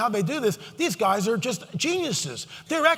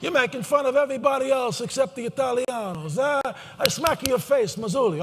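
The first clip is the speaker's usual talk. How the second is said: put-on Italian accent; 3 semitones higher than usual. Preacher teasing Marzulli, so Italians aren't left out, mock Italian accent. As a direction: joke in a mock Italian accent, like a street tough. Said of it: "I better do my Italiano accent".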